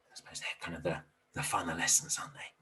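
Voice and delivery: soft unvoiced aside; whispered aside. Real, not acted. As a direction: drop to a whisper.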